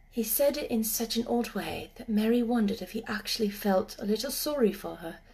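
plain reading voice

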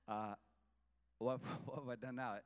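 chuckling, innocent what-did-I-do; laughing shrug. Retold or imitated. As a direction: laugh through the question. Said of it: "And David said".

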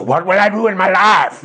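high, strained mock wail; hammed-up plea at bursting point; intense desperation. Believer obsessing before God, hammed-up desperate wail. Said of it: "it builds an intensity".

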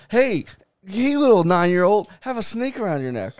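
high, loud, coaxing showman voice; mock zoo handler. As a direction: lure a kid like a carnival barker.

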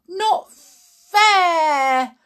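high, loud protest; indignant shout. A narrator's reading. George protesting the escape, shouted. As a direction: shout it like a cheated child. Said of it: "shouted George".

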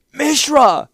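high, loud desperate shout. A narrator's reading. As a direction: call out across the chaos. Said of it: "he shouted".

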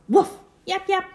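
high yappy barks; yappy dog voice. Acted. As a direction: yip like a tiny dog. Dogs barking.

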